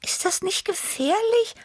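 tiny, very high awed voice; high, hushed-sounding character question; Tibi, breathed question. A narrator's reading. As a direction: breathe it out in a high timid-cat voice. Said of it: "hauchte Tibi".

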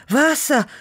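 high, loud desperate gasp; desperate parched cry; panting. A narrator's reading. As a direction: gasp it out, dying of thirst. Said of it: "keuchte ich nach zwei Stunden".

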